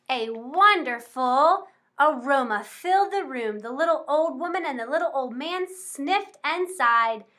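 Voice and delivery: usual speaking voice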